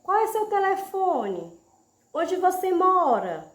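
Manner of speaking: high, lifted question tune; asking a question. Shown as a demonstration. Teacher demonstrating a question to her class, high questioning tone. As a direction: ask it like a real question. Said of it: "Assim também como você pode dizer".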